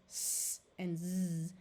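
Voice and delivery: slow hissed s, then buzzed z; sounds of S. Shown as a demonstration. Teacher contrasting the two S sounds, slowly. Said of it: "S has two sounds".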